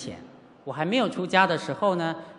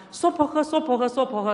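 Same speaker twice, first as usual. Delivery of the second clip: high-pitched flustered chanting, acted out; panicked mantra muddle; 8 semitones higher, 3 dB louder than usual. Teacher mocks unpractised chanters in emergencies, panicked mantra muddle. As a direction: gabble the mantra in mock panic. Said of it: "真正紧急不安".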